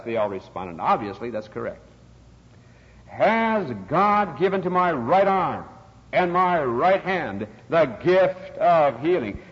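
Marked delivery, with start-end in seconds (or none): 3.1-9.4 slow, louder revivalist declamation; imitated healer, raised and emphatic; faith healer rousing crowd. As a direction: proclaim each phrase slowly to the crowd.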